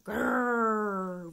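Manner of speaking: put-on loud toddler dinosaur growl; playful growl. A narrator's reading. George answers his mended toy with a playful growl. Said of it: "replies George".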